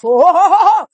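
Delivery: booming preacher's ho ho ho laugh; big belly laugh mid-sermon; hearty laughter. Real, not acted. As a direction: laugh out loud in triumph. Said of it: "because you are going to laugh".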